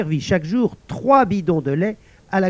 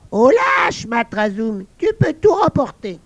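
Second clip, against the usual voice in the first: high loud call; old men commanding; 8 semitones higher, 4 dB louder than usual. Acted. Old men ordering the table cleared, loud call. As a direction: summon the servant loudly. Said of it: "puis ils commandèrent".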